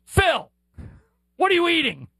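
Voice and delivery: put-on high-pitched little-kid question; imitated small child. Young son quizzing a famous coach about breakfast, imitated child's high voice. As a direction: pipe up like an unfiltered preschooler.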